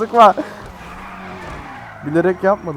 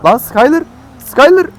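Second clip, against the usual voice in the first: high loud call of a name; 8 semitones higher, 9 dB louder than usual. Real, not acted. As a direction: call out across the street. Calling out to friend.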